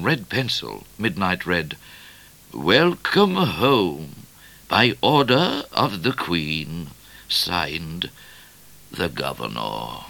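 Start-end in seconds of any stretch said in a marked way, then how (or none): 2.5-10.0 slow, drawn-out reading; official message. A narrator's reading. Midnight reading the Governor's message, slow official reading. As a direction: read it out ceremoniously, word by word.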